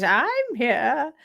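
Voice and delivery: swooping theatrical film-star entrance; Tim Curry in Rocky Horror. Retold or imitated. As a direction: lightly imitate a grand musical entrance. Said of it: "when Tim Curry did that".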